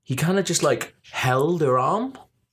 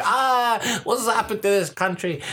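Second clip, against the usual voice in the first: put-on high, slurring drunk voice; mock drunk party invite; drunk woman; 7 semitones higher than usual. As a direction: ham up a tipsy stranger begging for company. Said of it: "one of those drunks".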